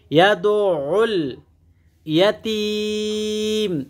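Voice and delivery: slow, drawn-out chanted recitation; Quran recitation. Shown as a demonstration. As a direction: recite with long melodic stretches. Recites the verse. (ধীর সুরেলা কুরআন তিলাওয়াত)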